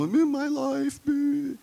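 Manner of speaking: high, far, sing-song mockery of a needy person; mock them in a silly high voice; mocking whine. Preacher mimics people unloading their troubles, silly high whine.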